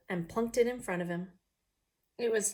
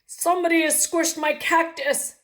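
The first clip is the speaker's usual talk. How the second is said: much higher, much louder daddy voice; 8 semitones higher, 12 dB louder than usual. Acted. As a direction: boom out the complaint as the daddy. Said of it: "said the daddy person".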